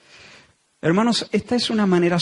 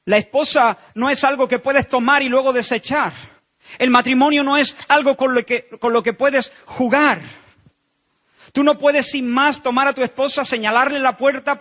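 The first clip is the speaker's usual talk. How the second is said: higher and louder, fervent preaching; preaching at full force; 7 semitones higher, 4 dB louder than usual. Acted. Preacher warning the congregation about divorce, fervent. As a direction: drive the point home from the pulpit.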